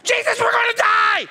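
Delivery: high, loud cry of terror; panicked shout. Retold or imitated. Disciples to Jesus as the boat sinks, panicked shout. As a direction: yell for help as if about to die.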